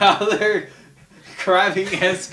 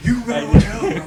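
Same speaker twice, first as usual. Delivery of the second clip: wrestler impression; 4 semitones higher, 6 dB louder than usual. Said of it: "I was trying to do Hulk Hogan".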